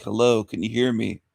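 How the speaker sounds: usual speaking voice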